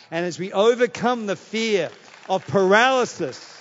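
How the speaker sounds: rally the room to their feet; impassioned sermon climax; full-force preaching. Acted.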